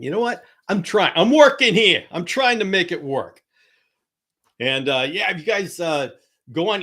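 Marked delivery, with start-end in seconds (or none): 0.0-3.4 mock exasperated. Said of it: "the mug makes it worse and funnier".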